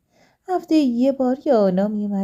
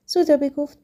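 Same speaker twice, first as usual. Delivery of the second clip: plain reading voice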